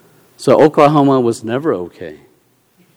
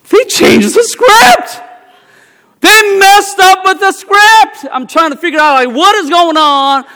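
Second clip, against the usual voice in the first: much higher, loud, slowed outrage; outraged shout; 8 dB louder than usual. Retold or imitated. Preacher reliving shock at the Oklahoma production, shouting. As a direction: cry out in disbelief at the change.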